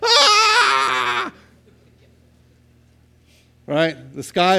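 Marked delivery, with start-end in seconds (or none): 0.0-1.3 high, loud mock scream of a frantic mind; panicked scream. Preacher acting out the anxious half of the mind, screaming. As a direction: let out a wild panicked "Ah!". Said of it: "screaming, waving its hands in the air".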